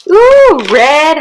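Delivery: high loud delighted ooh; excited. Real, not acted. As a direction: squeal with glee. Guessed the red lipstick.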